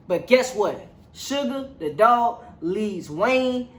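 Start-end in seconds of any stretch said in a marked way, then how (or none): none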